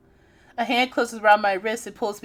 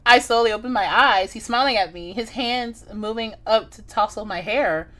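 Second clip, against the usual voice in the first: usual speaking voice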